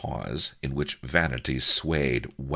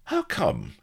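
suspicious colonel. Acted. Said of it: "looked narrow-eyed on Solomon".

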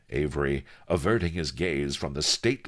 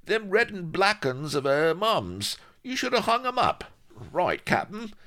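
very high-pitched sidekick voice, swearing in exasperation; high, excitable crewman; agitated pirate sidekick; 3 dB louder than usual. A narrator's reading. Voices Vladimir in story.

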